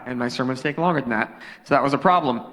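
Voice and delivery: normal talking voice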